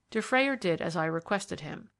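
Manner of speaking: plain reading voice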